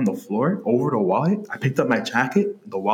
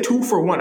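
"two" punched higher in "two for one"; stress on "two"; 8 semitones higher than usual. Real, not acted. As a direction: lean on the word "two", pitched up. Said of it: "I emphasize the word two for one".

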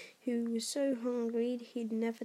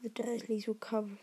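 ordinary delivery

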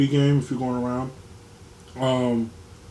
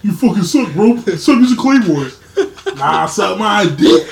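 loud, higher taunting, half-laughing; mock trash talk; 6 semitones higher, 9 dB louder than usual. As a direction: hurl playful game-chat insults, loudly. Voicing trash talk in game chat.

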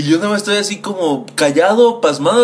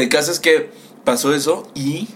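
ordinary talk, nothing marked

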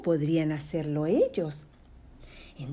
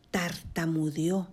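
plain reading voice

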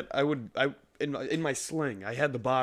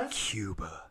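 breathy whispered aside; 5 semitones lower, 7 dB quieter, slower than usual. Acted. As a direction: whisper it softly.

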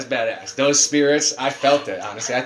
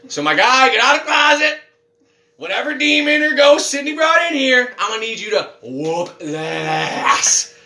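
mock rallying call; 6 semitones higher than usual. Rallying the closet spirit to fight.

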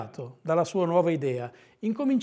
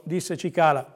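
ordinary delivery

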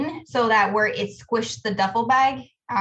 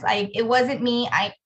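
ordinary talk

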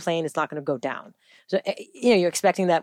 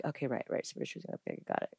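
very soft, lower self-talk; meek acceptance; 4 semitones lower, 15 dB quieter, faster than usual. Retold or imitated. Woman accepting advice about timing, hushed mock compliance. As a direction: give in under your breath. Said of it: "I'm just like".

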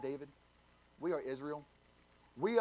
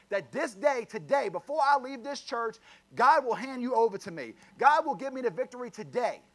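rising, louder preacher's proclamation; preaching at full force; 4 semitones higher, 5 dB louder than usual. Real, not acted. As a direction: declare it over the church with fire. Preacher builds to declaration.

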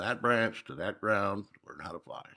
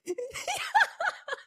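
high, loud peal of laughter; laughing; 6 dB louder than usual. Real, not acted. Listener reacting to his childhood name, laughing.

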